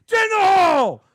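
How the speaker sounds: loud, high-pitched gallery yell; rowdy golf fan. Acted. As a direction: holler it like a golf fan after the tee shot. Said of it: "here's my impersonation of every golf fan".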